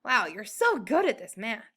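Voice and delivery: ordinary delivery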